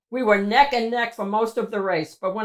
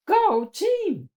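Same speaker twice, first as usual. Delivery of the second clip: high, bright cheer; root for the team; cheery go team; 11 semitones higher than usual. Acted. Reformed cheese cheering others on, high and bright.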